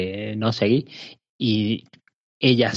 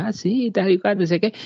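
mocking host voice, pitched high; high, jokey presenter imitation; joking TV host; 9 semitones higher than usual. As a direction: toss it off like a smug joking presenter. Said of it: "va diciendo de ahí como chistecillo".

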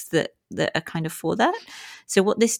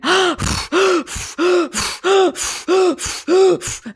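loud gasping breaths; 13 semitones higher, 4 dB louder than usual. Shown as a demonstration. Demoing breathwork hyperventilation.

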